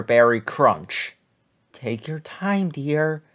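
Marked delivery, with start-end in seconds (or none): 1.7-3.2 softly, much quieter, a gentle mother; quiet, kind mother; gentle mommy voice. A narrator's reading. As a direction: soothe him like a patient mommy. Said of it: "said his mommy".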